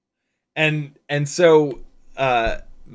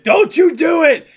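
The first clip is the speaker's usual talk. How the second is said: high, loud retold scream; full-voiced, high-pitched re-enacted shout; screamed warning; 9 dB louder than usual. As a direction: yell it up at someone to stop him. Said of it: "I actually screamed up there".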